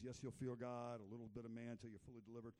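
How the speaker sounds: normal talking voice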